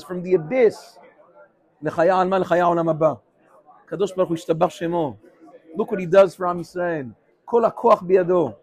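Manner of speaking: normal talking voice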